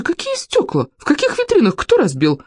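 very high startled outburst; fly up in alarm; flaring up. A narrator's reading. Saleswoman startled by talk of broken glass, flaring up. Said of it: "взвилась продавщица".

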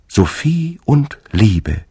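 slow, high triumphant cry; triumphant call. Acted. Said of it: "rief triumphierend Fabel".

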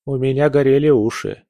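plain reading voice

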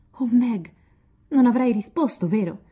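high, loud, alarmed question; mother dreading the answer; anxious hurry. A narrator's reading. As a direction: fear she already answered. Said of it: "s'affrettò a chiedere".

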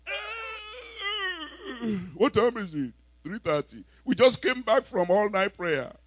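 high, loud startled cry on waking. Retold or imitated. Woken neighbours crying out in surprise at the hour. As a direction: jolt awake with a high cry and keep it up.

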